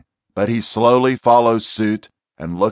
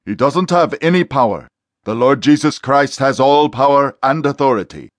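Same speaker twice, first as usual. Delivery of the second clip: louder, higher commanding voice; stern command; 5 semitones higher, 4 dB louder than usual. Acted. Exorcist ordering the demon to the front, stern command. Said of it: "Tom commands".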